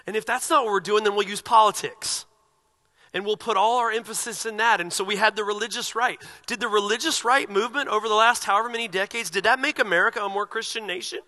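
loud, high, driving preacher voice; fiery preaching. Acted. Pastor rousing his congregation about celebrities, loud and fiery. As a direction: preach it out with full force.

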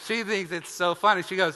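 ordinary talk

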